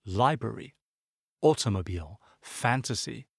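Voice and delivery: "Library, automobile, fantasy", each stressed on the first syllable; strong openings on "Library, automobile, fantasy", trailing syllables light. Shown as a demonstration. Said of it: "accent sur la première syllabe".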